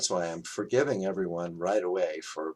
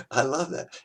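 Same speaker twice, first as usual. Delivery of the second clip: laughing delight; 7 semitones higher than usual. Real, not acted. Delighted by the quote.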